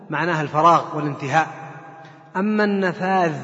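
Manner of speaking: ordinary delivery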